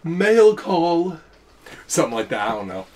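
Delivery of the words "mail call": divine voice. Retold or imitated. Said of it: "that divine".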